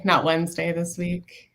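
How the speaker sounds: usual speaking voice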